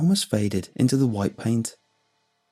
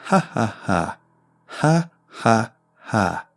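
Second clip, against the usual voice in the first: forceful mocking laugh; slower than usual. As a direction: pound out each ha. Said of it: "shaking me with each ha".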